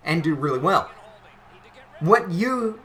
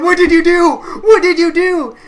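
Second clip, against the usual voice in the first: furious high yell, much louder; angry sister; 13 semitones higher, 12 dB louder than usual. Retold or imitated. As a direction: sister screaming an accusation. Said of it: "she's going".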